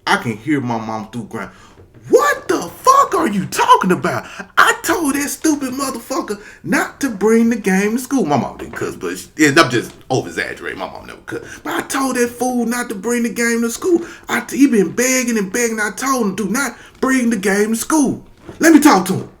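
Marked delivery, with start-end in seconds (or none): 2.1-8.2 high, loud angry mother yelling; furious mom. Retold or imitated. Angry mom voiced on phone. 11.6-19.3 high, loud angry mother yelling; furious mom. Retold or imitated. Mom still ranting.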